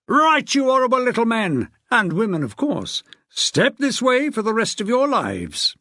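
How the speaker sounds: hammed-up drill-sergeant call into the corridor; mock yell. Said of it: "hauling open his door and yelling".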